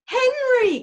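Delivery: very high, loud anguished cry of his name. A narrator's reading. Wife calling husband who fell, panicked cry. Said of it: "cried Missus Brown".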